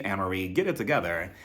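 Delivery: ordinary talk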